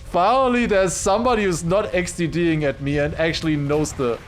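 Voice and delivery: loud, high delighted rant; excited relief. Real, not acted. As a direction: gush gratefully at chat.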